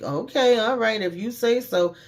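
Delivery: retold sarcastic brush-off; sarcastic retort. Mocking reply to sociopath jab.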